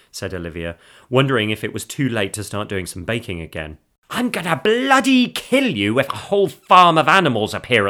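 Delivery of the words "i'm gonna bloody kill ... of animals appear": loud, high-pitched exasperated threat. Acted. Wife threatening her singing husband, loud exasperation. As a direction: threaten him loudly, voice pitched right up.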